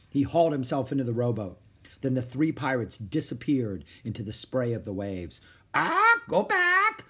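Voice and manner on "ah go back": piercingly high, drawn-out bird screech; parrot squawk. A narrator's reading. Parrot warning the children, squawking. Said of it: "squawked Polly".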